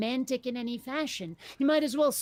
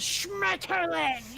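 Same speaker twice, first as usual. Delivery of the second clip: slow, drawn-out mock yell of Schmetterling; screamed German; 6 semitones higher, much slower than usual. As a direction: hammer Schmetterling out like a war cry. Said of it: "one guy goes".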